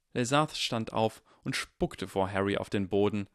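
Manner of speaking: ordinary delivery, nothing marked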